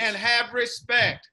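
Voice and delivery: slow, loud chant; call-out shout. Shown as a demonstration. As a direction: shout it for kids to echo. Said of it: "continue to repeat after me".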